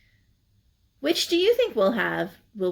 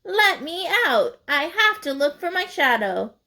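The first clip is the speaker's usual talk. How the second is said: mild shout; 7 semitones higher than usual. A narrator's reading. Groundhog in the bag cries out to be let out. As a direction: protest from inside the bag. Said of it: "he shouted".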